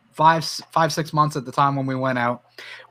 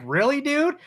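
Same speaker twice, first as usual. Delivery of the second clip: incredulous; 8 semitones higher than usual. Retold or imitated. Mocking a kid who fled.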